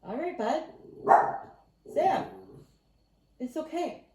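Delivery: high, coaxing voice for her pet; pet talk. Real, not acted. Soothing her waiting pet Sam, high coaxing pet voice. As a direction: coo at the dog to settle him.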